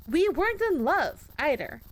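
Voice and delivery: bitter yell at the ghost; angry shout. Acted. Shouting back at husband.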